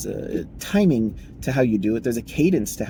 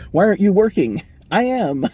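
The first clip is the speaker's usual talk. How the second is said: mock boss and worker exchange; 8 semitones higher, 6 dB louder than usual.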